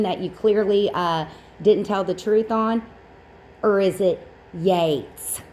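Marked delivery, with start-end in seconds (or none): 3.6-5.4 drawn-out question. Shown as a demonstration. Mock questioning of a name.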